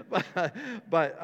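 laughing. Real, not acted. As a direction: stumble on through your own laugh. Laughs at own joke mid-sentence.

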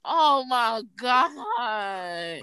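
long, drawn-out dismay; real exasperation. Real, not acted. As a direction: drag it out in slow disbelief. Despairing at the friends' mock accents.

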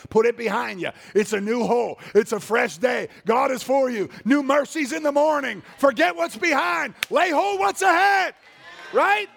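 preacher rising into a high shout; preaching at full force. Acted. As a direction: build the encouragement to a roar.

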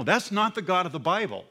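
ordinary talk

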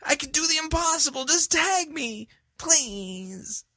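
very high falsetto plea; mock a desperate God; whiny begging God. Critic mocking God as a tag-team partner. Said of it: "And he says".

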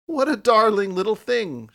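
high, loud delighted exclamation; delighted cry. Acted. Girl spotting the kitten, high delighted cry. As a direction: gush over the kitten. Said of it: "she cried".

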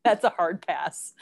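ordinary talk

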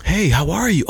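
high, polite office greeting; professional voice. Retold or imitated. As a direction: switch on the polished work voice. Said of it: "my professional voice".

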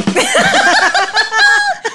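big high-pitched burst of laughter; loud high laugh. Real, not acted. Guest laughs at host's flattery.

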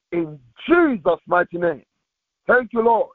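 usual speaking voice

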